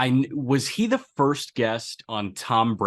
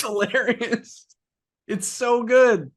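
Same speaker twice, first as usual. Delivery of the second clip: high laughing voice; 11 semitones higher, 4 dB louder, slower than usual. Real, not acted. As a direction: laugh the words out. Cracking up at the story.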